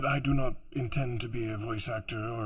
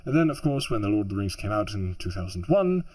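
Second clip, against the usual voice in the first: usual speaking voice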